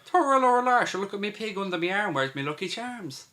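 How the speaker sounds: a cartoon leprechaun brogue, played for laughs; mock leprechaun Irish. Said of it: "proper, like, fucking leprechaun".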